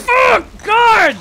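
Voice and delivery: sudden high loud cry of pain; pained outcry. Real, not acted. Gamer yelping at the screen as he gets hit. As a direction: yelp as the hit lands.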